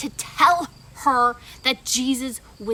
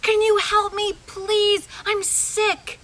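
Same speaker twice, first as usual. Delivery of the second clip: pleading patient; 6 semitones higher, 4 dB louder than usual. Acted. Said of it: "and she's like".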